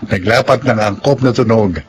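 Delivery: measured, formal read of the cast credits; radio drama announcer. Acted. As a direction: introduce the show like a radio host. (boses ng tagapagpakilala sa radyo drama)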